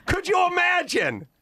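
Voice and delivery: usual speaking voice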